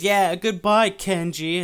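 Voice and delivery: loud, high mock farewell call; retold yelling. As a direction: holler a goodbye as if across the street. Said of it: "they're, like, yelling at this guy".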